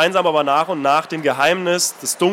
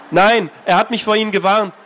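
higher, louder defiant refusal; Anna's defiant reply; 3 semitones higher, 3 dB louder than usual. Acted. Anna refusing the old man, defiant. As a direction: push back, wary.

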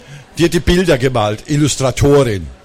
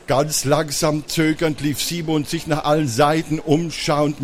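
ordinary delivery

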